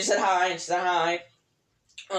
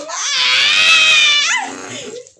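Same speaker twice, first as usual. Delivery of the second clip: loud high cackling laugh; a big squealing burst of laughter; shrieking laugh; 10 dB louder than usual. Real, not acted. Cracking up at her friend's joke, shrieking laugh.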